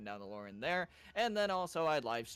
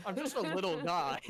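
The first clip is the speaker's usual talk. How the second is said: louder, higher little-guy voice; old grandpa voice; 6 semitones higher, 6 dB louder than usual. Retold or imitated. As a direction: play it small and innocent. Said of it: "an old grandpa voice".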